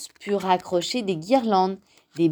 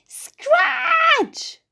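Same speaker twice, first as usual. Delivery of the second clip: very high, stretched "scratch"; ripping sound effect; 4 dB louder than usual. A narrator's reading. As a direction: act out paper tearing noisily. Narrator acts out tearing paper. (bruit de papier déchiré)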